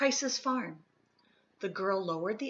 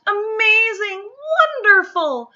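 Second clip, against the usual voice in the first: high, loud delighted cheering; amazed applause calls, much higher and louder; crowd cheer; 13 semitones higher, 14 dB louder than usual. A narrator's reading.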